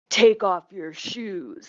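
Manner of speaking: mock booking officer. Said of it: "He's like".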